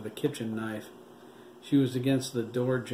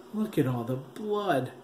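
near tears; 4 semitones higher than usual. A narrator's reading. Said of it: "tears threatening".

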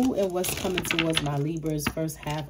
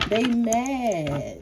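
sing-song taunt, pitched up; teasing higher repeat; 4 semitones higher than usual. Shown as a demonstration. Repeating to the viewer that the haters are mad, in a teasing taunt. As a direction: rub it in playfully. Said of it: "And they mad".

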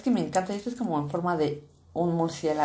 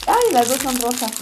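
louder surprised exclaim; 5 semitones higher, 6 dB louder than usual. Real, not acted. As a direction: react to the find. Finds two pink balls.

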